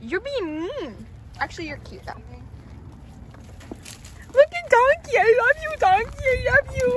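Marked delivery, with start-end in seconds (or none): 4.3-7.0 high, loud gushing pet voice. Real, not acted. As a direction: gush over the donkey. Cooing at the donkey.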